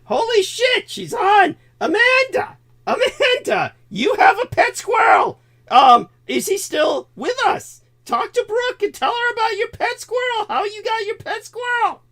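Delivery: real excitement greeting a friend on call; excited shout. Real, not acted. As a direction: call out to her with delight.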